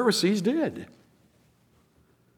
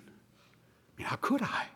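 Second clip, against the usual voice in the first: whispered aside; 8 semitones higher, 13 dB quieter, slower than usual. Acted. Aside about obeying the vision.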